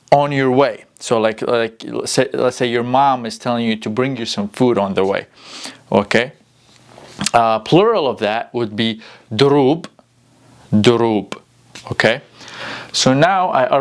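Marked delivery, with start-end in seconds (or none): none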